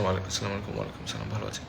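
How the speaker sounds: ordinary talk, nothing marked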